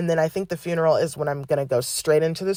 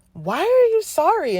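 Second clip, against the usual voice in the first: incredulous question; 12 semitones higher than usual. Retold or imitated. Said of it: "And I was like".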